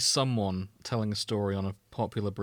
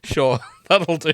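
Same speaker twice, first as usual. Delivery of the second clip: laughing; 6 semitones higher, 10 dB louder, slower than usual. Real, not acted. Laughing reply to arm joke.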